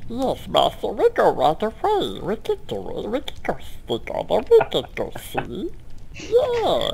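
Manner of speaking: cringing lackey answering his master; hunchback assistant. Acted. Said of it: "huge opportunity to use this voice".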